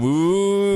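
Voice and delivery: high, mock-ominous ooh; spooky ooh. Host teasing a coming disaster, spooky ooh. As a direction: wail a teasing ghostly ooh.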